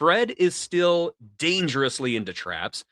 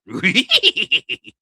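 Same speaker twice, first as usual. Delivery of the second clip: imitating a spooky laugh heard in the dark, higher and louder; a ghostly laugh echoing out of a dark room; mock spooky laugh; 6 semitones higher, 7 dB louder than usual. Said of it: "from the distance you hear".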